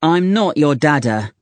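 ordinary delivery

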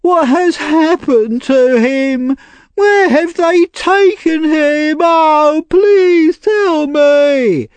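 very high, slow, hammed-up wail; pretend weeping. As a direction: fake weeping, stretched out and piercingly high. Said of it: "pretending to weep".

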